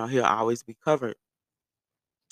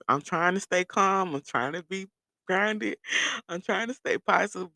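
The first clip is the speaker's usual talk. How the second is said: forced calm; 7 semitones higher than usual. Retold or imitated. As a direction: drag out each attempt to hold it together. Acting forced calm over dead car.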